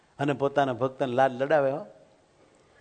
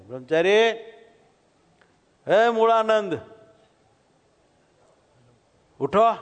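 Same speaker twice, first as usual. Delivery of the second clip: high, louder call to wake him; 7 semitones higher, 3 dB louder than usual. Acted. Maharaj calling the sleeping brahmachari awake at night.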